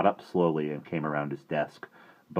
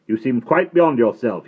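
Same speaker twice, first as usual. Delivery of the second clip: sharp headmaster, louder; sharply; 7 semitones higher, 10 dB louder than usual. A narrator's reading. Headmaster scolding a giggling boy, sharp. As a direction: cut him off sharply. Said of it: "he said sharply".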